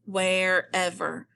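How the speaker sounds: WHEREVER split slowly into "where" and "ever"; syllable by syllable. Shown as a demonstration. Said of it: "is what is making our air sound".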